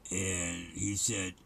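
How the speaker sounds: ordinary talk, nothing marked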